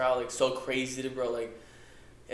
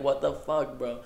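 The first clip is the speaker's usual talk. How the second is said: ordinary talk, nothing marked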